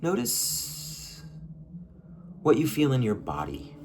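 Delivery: unhurried guiding voice with long pauses, softening as it goes; guided meditation. Acted. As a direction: lead them gently inward, leaving space between phrases. Leads a short guided meditation.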